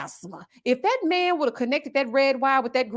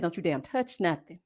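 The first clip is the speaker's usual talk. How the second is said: low warning; 7 semitones lower, 9 dB quieter than usual. Retold or imitated. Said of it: "I said".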